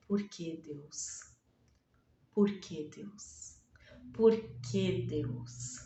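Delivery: súplica baixa e chorosa, quase sussurrada; chorando. Pretend. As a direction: implore a Deus entre lágrimas, bem baixinho. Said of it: "ela deveria estar chorando". (viúva chorando pergunta a Deus, voz baixa e chorosa)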